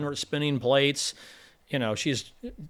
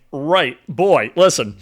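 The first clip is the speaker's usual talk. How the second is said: loud, dismissive king to his son; scolding king; 9 dB louder, much slower than usual. Retold or imitated. The old king brushing off his son, loud and scornful. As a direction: put the boy in his place. Acted father-son quarrel.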